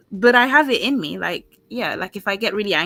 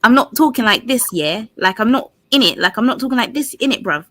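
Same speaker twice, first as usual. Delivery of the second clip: exaggerated London slang accent, punchy; mock a rougher London street accent; put-on London street accent; 4 dB louder than usual. Shown as a demonstration. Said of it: "let me put it on".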